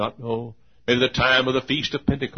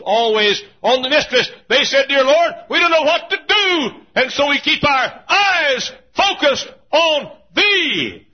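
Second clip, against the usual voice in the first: high, loud, ringing preacher's cry; preaching at full force; 8 dB louder than usual. Acted. Preacher to congregation on the feast trips, full-force preaching. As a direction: lift the crowd with rising fervor. Preaching, voicing pilgrims' prayer.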